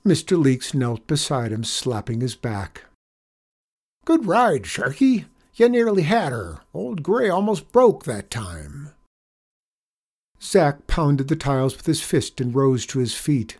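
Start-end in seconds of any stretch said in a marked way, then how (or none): 4.0-8.9 higher, lively cowboy coaching voice; folksy cowboy janitor, cheering. A narrator's reading. Janitor praising the boy after his ride, lively. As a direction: praise the rider in a pitched-up voice.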